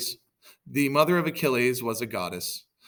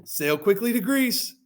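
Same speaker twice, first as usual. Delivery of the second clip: loud, very high cry; hammed-up shouted order to set sail; acted shout; 11 semitones higher, 7 dB louder, slower than usual. Agamemnon orders the fleet home, high urgent shout. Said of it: "Agamemnon shouted".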